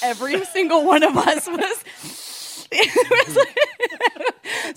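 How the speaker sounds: high breathless laughter; giggling through the words; laughing. Real, not acted. Laughing recalling the turns.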